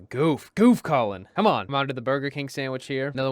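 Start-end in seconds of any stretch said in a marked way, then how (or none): 0.0-1.6 loud teasing scold at himself; mock-scold yourself, loudly; self-scolding shout. Real, not acted.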